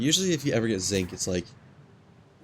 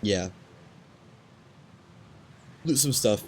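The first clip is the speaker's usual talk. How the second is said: ordinary delivery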